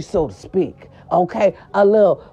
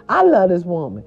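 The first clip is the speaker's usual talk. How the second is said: normal talking voice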